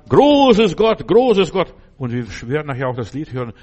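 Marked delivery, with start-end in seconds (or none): 0.1-1.7 loud, high awed cry; ecstatic worship. Retold or imitated. Villagers on their knees praising God, loud awed cry. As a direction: cry out in amazement. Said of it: "gehen auf die Knie und beten an".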